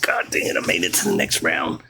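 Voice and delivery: excited whisper, retold; hushed, voiceless mock celebration. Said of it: "I was so excited".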